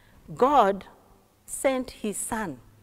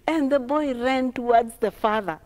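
ordinary delivery, nothing marked

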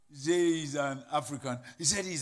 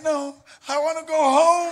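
desperate laughing plea; 9 semitones higher, 9 dB louder than usual. Retold or imitated. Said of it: "He said".

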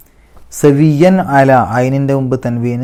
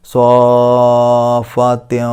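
സ്വാഫാത്തിൻ with the സ്വാ held for six counts, very slow; long madd; much slower than usual. Shown as a demonstration. Teacher demonstrates the six-count stretch. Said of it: "ആറ് അക്ഷരത്തിൻ്റെ ദൈർഘ്യം".

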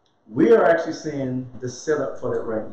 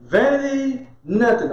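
slow proclamation; 6 semitones higher, much slower than usual. Retold or imitated. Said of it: "He said".

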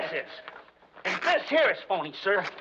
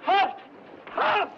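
hammed-up heat madness; 9 semitones higher, 4 dB louder than usual.

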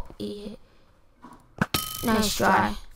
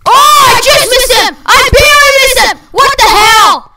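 loud, high furious yelling; raging gamer; 10 semitones higher, 6 dB louder than usual. Real, not acted. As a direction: scream at the missed shot. Rage after missing shot.

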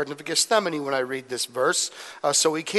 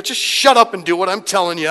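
louder mock maternal order; acted-out telling-off; bossy mother; 3 semitones higher, 7 dB louder than usual. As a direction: overrule your son firmly. Voices mother overruling her son.

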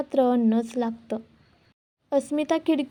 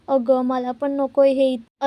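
usual speaking voice

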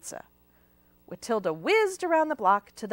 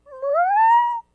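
a much higher, louder wailing cat cry; crying meow; 12 dB louder than usual. A narrator's reading. Hungry cats wailing at Watilda, high loud meow. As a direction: yowl it like a starving kitten.

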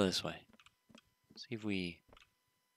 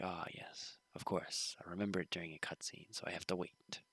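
a hint of a smug sage, whispered; mock-solemn musing; mock wise voice; 4 semitones lower, 13 dB quieter than usual. Gamer mocking the game's timing, whispered. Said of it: "mocking game logic".

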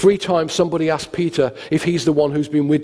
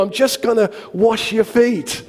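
high, slow, exasperated insistence; hammed-up strained patience; 7 semitones higher than usual. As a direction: spell it out to an over-eager friend. Exasperated first take, comic.

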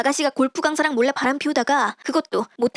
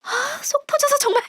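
exasperated; 12 semitones higher than usual. Acted. Frustrated daughter-in-law bursts out.

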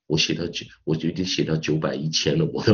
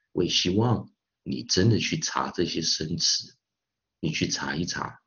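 ordinary talk

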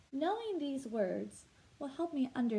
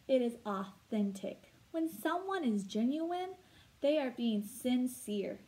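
usual speaking voice

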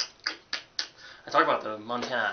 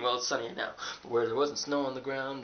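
ordinary talk

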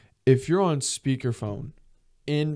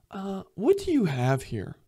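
lightly voiced clueless customer, higher and softer; 6 semitones higher, 5 dB quieter than usual. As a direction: imitate a customer asking cluelessly. Imitating clueless restaurant customers.

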